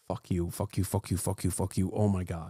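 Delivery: very low, quiet repeated swearing; real fed-up grumbling, low and soft; muttered cursing. Real, not acted. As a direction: mutter it under your breath at the screen. Swearing at the played clip.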